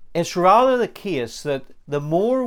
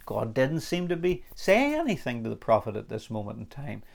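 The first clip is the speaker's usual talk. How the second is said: usual speaking voice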